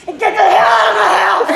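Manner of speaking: loud furious shout; shouted rant. Acted. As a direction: yell them out of the house. Ranting at dog food company.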